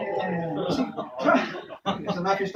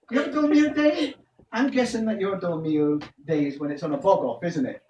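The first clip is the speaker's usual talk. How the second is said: usual speaking voice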